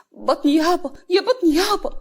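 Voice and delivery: high, loud cry for the belly; acted agonized wailing; pained wail. A narrator's reading. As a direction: moan in cramping pain. Running off with stomach cramps.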